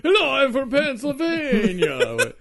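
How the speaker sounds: high, loud, stretched Pennsylvania caricature; mock Pennsylvania accent. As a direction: wail it in a silly Pennsylvanian accent. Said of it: "I don't know what Pennsylvanians' accents are".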